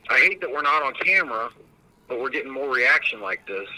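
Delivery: ordinary delivery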